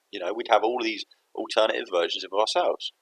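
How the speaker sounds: normal talking voice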